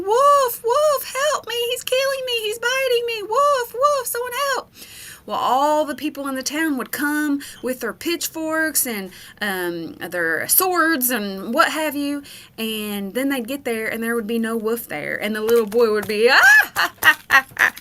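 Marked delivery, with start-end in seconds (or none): 0.0-4.7 put-on high, panicked child's yelling, a bit fast; a much higher, hammed-up cry of wolf; boy screaming for help. A narrator's reading. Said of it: "he would start screaming and yelling". 16.3-17.7 loud mock belly laugh; hammed-up loud laughing; boy cracking up. A narrator's reading. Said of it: "cracking up laughing".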